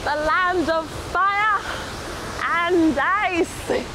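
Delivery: high, loud, slow tourism-ad welcome; grand announcer intro. Acted. Vlogger opens her Iceland video, announcer voice. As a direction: announce it like a travel advert.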